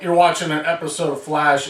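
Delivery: usual speaking voice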